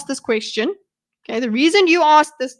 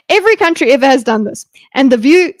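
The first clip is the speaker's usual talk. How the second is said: loud, fast, hammed-up echo of the clip; mock loud parroting; 9 dB louder, faster than usual. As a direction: bark the line back at him mockingly. Mocking the clip loudly.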